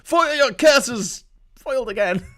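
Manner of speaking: high, slow hammed-up villain cry; mock villain curse; cartoon villain. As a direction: shake a fist like a foiled baddie. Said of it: "I said foiled as well".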